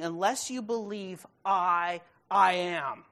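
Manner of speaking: "I, I am" slow, with a loud, heavy "I am"; stress on I AM. Shown as a demonstration. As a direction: punch "I am" hard. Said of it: "Here he's saying".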